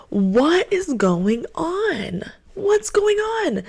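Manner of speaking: high, drawn-out disbelief; dramatic, stretched-out cry of confusion; bewildered outcry. Acted. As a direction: wail the question slowly in high-pitched bafflement. Bewildered outburst after a breakup.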